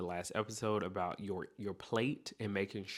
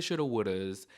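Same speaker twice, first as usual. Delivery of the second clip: ordinary talk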